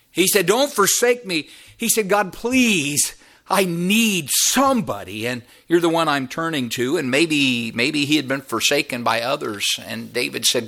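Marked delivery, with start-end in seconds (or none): 0.5-1.5 high, urgent begging; imitated plea. Preacher voices David begging God, urgent plea. 2.1-7.0 high, drawn-out begging, settling at the end; imitated desperate plea. Preacher voices David begging God, desperate plea.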